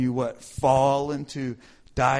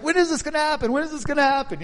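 much higher eager repeat; impatient eagerness; 10 semitones higher, much faster than usual. Retold or imitated. Retelling his own much higher eager impatience. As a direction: itch to get started, pitch up. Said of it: "I'm like".

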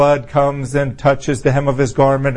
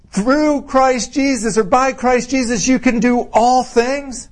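high-pitched, slow proclamation; triumphant; 7 semitones higher than usual. Real, not acted. Preacher restating the promise in Christ, triumphant. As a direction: proclaim it with rising conviction.

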